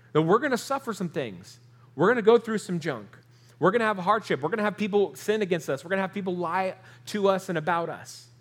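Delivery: fervent preacher rising over the congregation; preaching. Acted. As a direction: drive each line home from the pulpit. Building litany of hardships.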